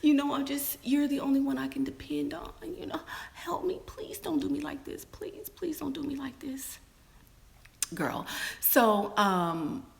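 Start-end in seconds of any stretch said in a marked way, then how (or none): 0.0-6.8 high, whispered mock sob; fake crying plea. Woman fake-crying to get back in, whispered pleading. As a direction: whimper it out, put on tears. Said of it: "I was crying and saying".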